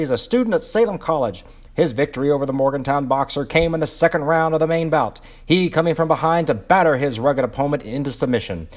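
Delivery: put-on loud, high-pitched old-time newsreel announcer; 1930s sports commentator. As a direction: call it like a thirties boxing commentator, punching the words. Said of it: "my best nineteen thirties commentator voice".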